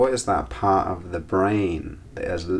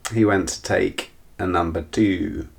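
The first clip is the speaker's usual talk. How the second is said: ordinary talk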